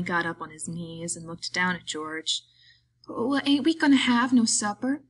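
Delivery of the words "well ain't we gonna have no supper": higher, louder simple-minded voice; big simple fellow, pitched up; Lenny's simple plea. Acted. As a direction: ask like a worried, hungry child. Lenny asks George about supper.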